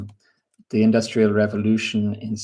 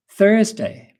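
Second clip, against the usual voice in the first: Thursday announced high and loud; spring Thursday on them, high and bright; excited teacher reveal; emphatic word; 10 semitones higher, 5 dB louder than usual. Shown as a demonstration. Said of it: "The next one is interesting".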